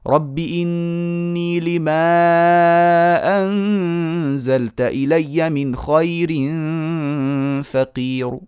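very slow melodic recitation with a faint damma on فقير; slow tajweed recitation. Shown as a demonstration. Teacher reciting the verse slowly to show rawm. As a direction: draw the verse out slowly, ending on a light damma. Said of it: "فأقول".

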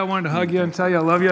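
usual speaking voice

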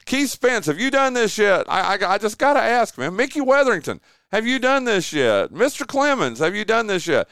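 high, eager call to each name; excited calling out. Real, not acted. Radio host quizzing colleagues on air, high excited call-out. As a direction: call out to your buddies on air.

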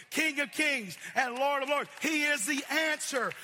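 preacher shouting his praise of the Lord; preaching proclamation. Acted. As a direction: proclaim each title with force. Proclaims Jesus as answer.

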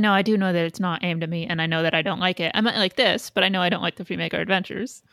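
normal talking voice

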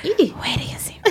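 imitating a breathy rap whisper. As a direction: whisper it like the ad-lib. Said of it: "the whisper".

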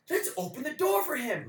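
high-pitched naive suggestion; hammed-up dumb enthusiasm; mocking imitation. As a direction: mimic an idiot's bright idea, high. Mimics the foolish crew's decision.

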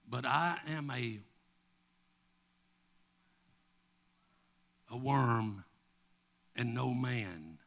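low voice with long pauses; solemn scripture reading. Real, not acted. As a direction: read it gravely, phrase by phrase. Reads psalm solemnly.

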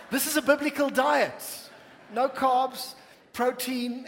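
playful preacher's shout; mock proclamation. Real, not acted. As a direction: proclaim it loudly, tongue in cheek. Teasing mock diet announcement.

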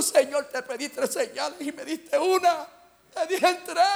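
high, whining protest, re-enacted; frustrated. Preacher protests to God over missing signs, frustrated. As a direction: complain upward like a let-down child. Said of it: "me tiro frustrado".

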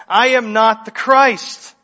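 "I am not the Christ" as a loud, measured declaration; emphatic denial. Acted. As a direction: deny it firmly, weighting each word of "I am not the Christ". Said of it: "notice how emphatic John is".

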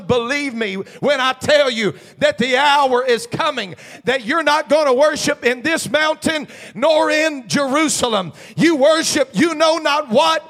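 loud, high fervent preaching; full-throated pulpit cry. Acted. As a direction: shout it out like a revival preacher.

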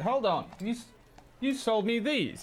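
high, insistent merchant. Acted. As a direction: press her in a high squeaky voice. Merchant voice continues.

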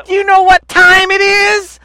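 loud, high, slow hype-man call; hype man shout. Retold or imitated. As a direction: bellow it like [name]. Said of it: "and go".

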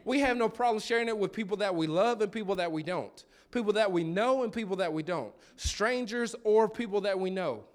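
impassioned pulpit declaration; preaching. Real, not acted. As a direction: drive the point home to the congregation. Preaches the closing point at force.

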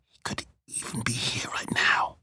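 hushed, secretive warning; eerie whisper. Acted. Friend warns of a presence, whispering. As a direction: whisper as if he might hear.

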